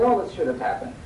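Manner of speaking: usual speaking voice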